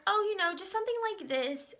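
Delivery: high, airy put-on politeness; high, casual request. Customer showing makeup artist a picture, high and casual.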